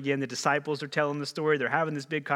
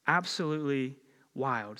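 usual speaking voice

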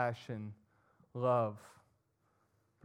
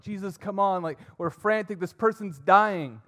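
much higher and louder, urgent pleading; frantic disciples; 7 semitones higher, 9 dB louder than usual. Acted. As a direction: shout at Jesus in panic as the disciples. Frantic disciples in chaotic crowd.

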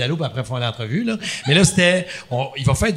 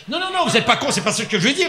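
flustered backpedal; 8 semitones higher, faster than usual. Retold or imitated. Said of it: "Puis il était là".

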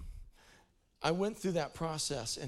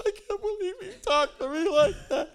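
high, loud, laughing self-pitying wail; mock crying whine; 11 semitones higher, 12 dB louder than usual. Preacher mocking his own crying after rebuke, hammed-up whine. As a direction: whine it like a wounded child, hammed up. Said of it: "I would start to cry".

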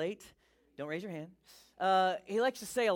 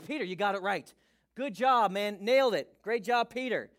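loud, raised-pitch cheering praise; hearty congratulation; 2 semitones higher, 7 dB louder than usual. Acted. Jesus praising Peter for his answer, excited acted congratulation. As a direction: cheer him on like a proud coach.

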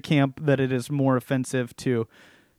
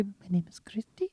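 whispered, shy intro; timid whisper; 5 semitones higher, 10 dB quieter than usual. Shown as a demonstration. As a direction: say it like you're scared of the clerk. Mock timid order.

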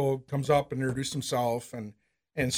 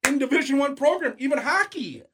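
pitched higher, bragging with a grin; excited; 8 semitones higher than usual. Real, not acted. Telling the podcast host, proudly excited. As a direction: pump it up with pride. Hyped about his team's reach.

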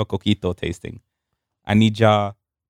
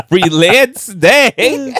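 laughing; 10 semitones higher, 6 dB louder, much slower than usual. Real, not acted. Laughing about free-balling.

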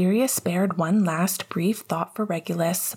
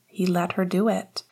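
ordinary delivery, nothing marked

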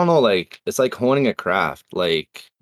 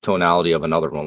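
usual speaking voice

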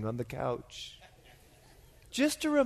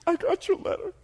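high, mock-weepy voice; tearful impression in a high register; 4 dB louder than usual. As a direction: imitate her crying down the phone. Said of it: "She's crying".

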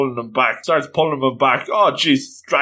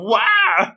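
high, comic falling cry; mock wail; 10 semitones higher than usual. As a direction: cry out high as if toppling over. Character falls to the ground.

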